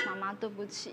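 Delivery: whisper-like apology to mum; quietly retold little sorry; soft child's apology. Son's soft apology quoted.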